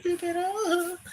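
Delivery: high, slow arcade game voice; video game noises. Acted. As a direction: voice it like a skate game announcer. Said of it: "I'm playing the video game noises".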